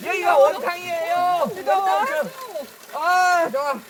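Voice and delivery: loud, high happy announcement; excited. Real, not acted. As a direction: call it out in delight. Shouting happily at the spring.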